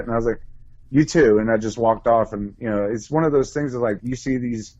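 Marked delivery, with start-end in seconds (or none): 0.9-1.4 higher, louder nervous blurt; flustered blurt. Retold or imitated. Answering Head, a flustered louder blurt.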